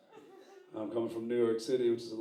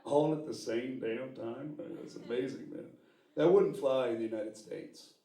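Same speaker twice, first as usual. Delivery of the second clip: ordinary delivery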